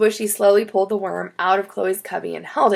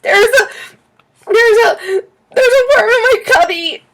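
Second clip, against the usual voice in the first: high, loud, stuttering panic; panicked screaming; 14 semitones higher, 10 dB louder than usual. A narrator's reading. Chloe tells teacher about worm in cubby, panicked screaming. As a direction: sputter it out in a shrieking fright. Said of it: "Chloe started running around the room screaming".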